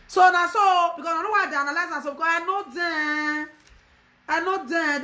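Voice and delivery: high-pitched, loud opening burst; exasperated, pitched well above normal; indignant rant. Real, not acted. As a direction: launch into a worked-up complaint. Heated rant about known liars.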